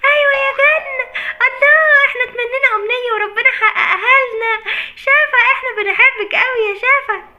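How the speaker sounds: high, loud, laughing squeals of joy; overjoyed girls. Acted. Girls greeting Shafa out of the tablet, laughing excitedly. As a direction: shriek with delight that the wish came true. Wish comes true, Shafa appears.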